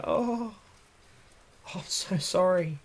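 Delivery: soft, high sheepish sorry; puppy apology. Acted. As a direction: make big puppy eyes while apologizing. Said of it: "turn on the whole puppy face".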